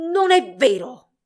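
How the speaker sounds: loud, slow, halting protest; stammered denial. A narrator's reading. Albert exposed by his rival, stammering denial. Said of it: "balbettò".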